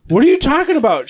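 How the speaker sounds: high, loud baffled protest; teacher fires back, baffled; indignant outburst. Retold or imitated. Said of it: "And he's like".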